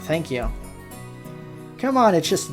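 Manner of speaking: ordinary talk, nothing marked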